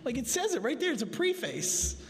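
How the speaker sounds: high-pitched mock protest, stressing "PREE-face"; insisting schoolboy protest. Preacher replaying his argument with teachers, high mock insistence. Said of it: "All my teachers used to yell at me".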